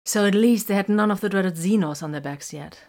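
ordinary delivery

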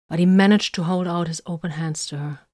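ordinary delivery

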